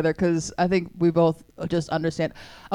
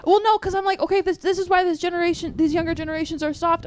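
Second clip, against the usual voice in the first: mock ranting elder; 8 semitones higher than usual. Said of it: "I was like".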